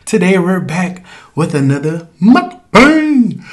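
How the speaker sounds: high, slow, gushing welcome back; bubbly high hello to the fans; excited greeting. Real, not acted. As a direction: beam at the viewers you missed.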